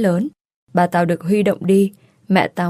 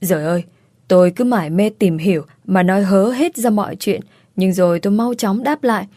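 ordinary delivery

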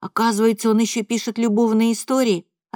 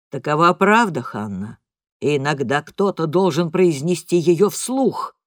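cold, cutting dad; father scolding mother, low and hard; low angry father; 5 semitones lower than usual. A narrator's reading. Father states hard truth.

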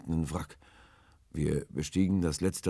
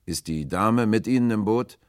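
loud, raised question; calling across; 6 semitones higher, 9 dB louder than usual. A narrator's reading. Will questions the man in the boat, calling loudly. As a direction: ask it loudly over the water.

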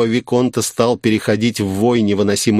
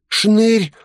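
put-on very high-pitched child voice; street boy's voice; 11 semitones higher than usual. A narrator's reading. Street boy telling his name to the lady, high child voice. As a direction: answer in a high little boy's voice.

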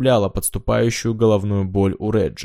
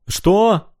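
much higher, startled shout; shouted question; 11 semitones higher, 4 dB louder than usual. A narrator's reading. Reggie shouts back to Zig, high and baffled. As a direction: shout it across in disbelief. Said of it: "прокричал Реджи".

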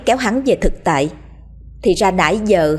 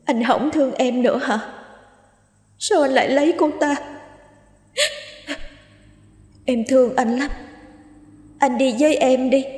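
high, soft, faraway lonely ghost; distant ghostly voice; 6 semitones higher, 3 dB quieter than usual. A narrator's reading. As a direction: call out like a lonely spirit from afar. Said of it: "tiếng nói văng vẳng".

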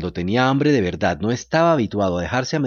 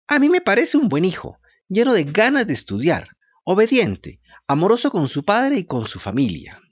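sweetly self-praising puppet; high puppet voice; 8 semitones higher than usual. A narrator's reading.

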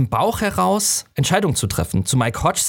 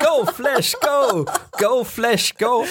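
high cheering chant, much higher; cheerleader; 10 semitones higher, much slower than usual. Retold or imitated. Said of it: "Dale dann als Cheerleaderin nebendran".

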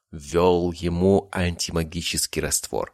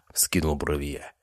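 plain reading voice

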